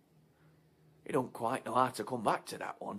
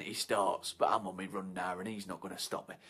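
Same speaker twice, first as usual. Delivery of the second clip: ordinary talk, nothing marked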